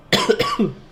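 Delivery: a sudden sick cough. Real, not acted. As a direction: cough out loud. Ill speaker coughs mid outro.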